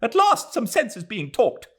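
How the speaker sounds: high, loud approving cry; fervent cry. Acted. Mrs Turton applauding the Major, loud fervent cry. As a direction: exult that someone agrees. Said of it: "Missus Turton cried".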